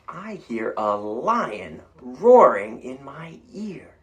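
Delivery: slow, loud storybook read; reading to children. A narrator's reading. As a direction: read it out slowly for the kids. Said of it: "reading aloud".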